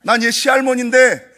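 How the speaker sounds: high, loud mock ghost voice; retold possessed grandma declaring she moved in; ghost's boast. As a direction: play the spirit smugly claiming the house. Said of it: "막 이러더라고요".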